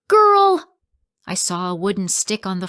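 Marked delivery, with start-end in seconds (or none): 0.1-0.8 much higher, much louder snarled shout; angry yell. Acted. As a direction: roar the word at her. Landlord yells at girl.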